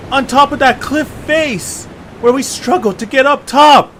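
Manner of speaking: disbelief, loud and high, at being back at the start; outraged puzzle-game hero. Acted. Etche shocked at being back.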